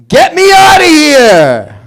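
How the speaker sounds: high, slow, loud New York yell; New York shout. Retold or imitated. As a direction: holler it like you're trapped in Queens. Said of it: "a guy doing an escape room in New York".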